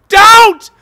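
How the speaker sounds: very loud, high despairing scream. A narrator's reading. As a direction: shriek it, all hope gone. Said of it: "a scream of utter despair".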